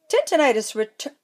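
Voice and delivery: ordinary delivery, nothing marked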